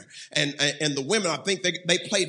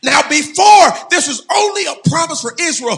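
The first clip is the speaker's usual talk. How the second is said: impassioned pulpit shouting, held notes; full-force preaching; 11 semitones higher, 15 dB louder than usual. Real, not acted. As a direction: preach it at the top of your voice.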